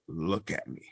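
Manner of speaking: low, hushed godlike command; lightly voiced deity, soft and low; All Father's voice. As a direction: grant permission gravely, with a long pause. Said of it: "And then he's like".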